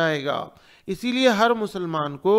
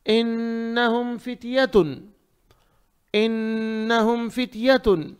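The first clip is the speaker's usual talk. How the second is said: slow recitation with a long humming n; tajweed recitation; 4 semitones higher, slower than usual. Shown as a demonstration. Teacher models an easy verse, measured tajweed. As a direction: recite it evenly, holding the doubled n.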